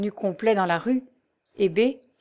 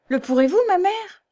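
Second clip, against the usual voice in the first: high, louder incredulous question; exclaiming; 11 semitones higher, 4 dB louder than usual. A narrator's reading. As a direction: exclaim it, lifting sharply in pitch on the mother. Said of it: "s'écria la jeune fille".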